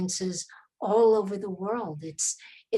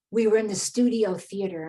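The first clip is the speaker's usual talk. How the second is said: normal talking voice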